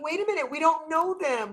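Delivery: high-pitched startled retort, retold; startled protest. Retelling her reaction to newcomers, startled protest. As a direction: act out a taken-aback objection, pitch jumping up.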